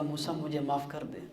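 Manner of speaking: soft pleading. Acted. Qarun begging Moses for mercy.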